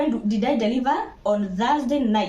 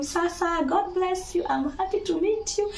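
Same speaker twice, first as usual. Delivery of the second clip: tender greeting; 9 semitones higher than usual. Retold or imitated. New mother greets her baby, high and tender. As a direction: coo a gentle welcome.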